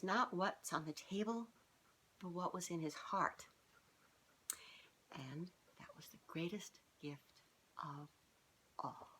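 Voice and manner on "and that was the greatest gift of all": soft whispered ending; hushed storyteller whisper. Acted. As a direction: breathe the last words out. Closing line of the story.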